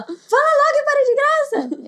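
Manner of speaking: high, loud impatient snap; impatient sister. Retold or imitated. Sister at the stairs pushing her to spit it out, impatient and loud.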